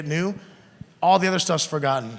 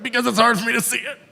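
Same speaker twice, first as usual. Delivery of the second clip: defensive reply; 6 semitones higher, 4 dB louder than usual. Retold or imitated. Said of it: "Be like".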